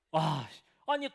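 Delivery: ordinary delivery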